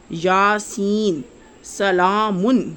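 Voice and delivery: یاسین and سلامن said slowly, long vowels stretched to double length; slow, elongated recitation of یاسین سلامن; drawn-out vowels. Shown as a demonstration. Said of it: "اس حرف کی آواز کو دگنا لمبا کر کے پڑھتے ہیں".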